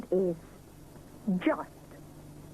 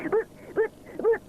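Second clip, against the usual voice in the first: high, repeated halting starts; light stammer; stuttering; 13 semitones higher than usual. Acted. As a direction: trip over the word. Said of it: "stutters Rupert".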